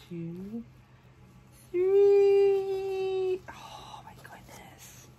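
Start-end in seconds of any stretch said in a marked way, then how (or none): 3.5-5.1 slow, breathless whisper; awed whisper. Real, not acted. Unveiling the baby's face, an awed whisper. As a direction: whisper in wonder at first sight. First reveal of the doll's face.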